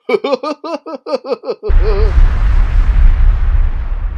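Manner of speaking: loud high cartoon guffaw; Goofy laugh. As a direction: hyuck it up loudly.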